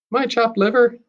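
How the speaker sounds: high, teasing joke; joking. Acted. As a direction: try to lighten the mood. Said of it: "Nana tries to joke".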